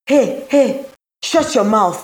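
high, sharp angry retort; spat back. A narrator's reading. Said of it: "she spat back".

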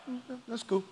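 soft, offhand shrug of a reply; mock indifference. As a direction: toss it off quietly, as if unimpressed. Acts indifferent reply to God.

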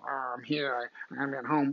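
soft, sullen grumble; mock sulky boy. Imitating husbands dragged along shopping, sulky and muttered. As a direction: pout like a pissed-off little kid. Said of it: "like five-year-old little boys just pissed off".

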